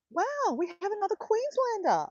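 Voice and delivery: high-pitched amazed squeal; soaring, excited wow; delighted surprise. Real, not acted. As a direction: marvel at finding a fellow local. Delighted to find another local viewer.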